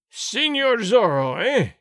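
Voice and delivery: loud, slow, outraged cry of the name; terrible cry. Acted. Sergeant roaring the outlaw's name at the tavern men, loud and slow. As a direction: spit the hated name out slowly and loud. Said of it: "Gonzalez cried in a terrible voice".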